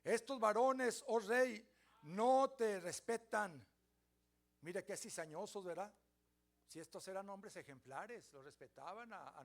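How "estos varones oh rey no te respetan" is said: louder, slow, drawn-out tattling to the king; sly accusers. Acted. Chaldeans accusing the youths to the king: loud, slow tattling. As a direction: lay the accusation on thick and slow.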